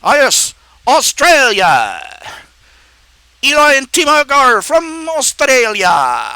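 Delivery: high, hammed-up Australian announcement; Australian accent. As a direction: draw out the name in a broad Aussie accent. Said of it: "I just lost my Australian accent".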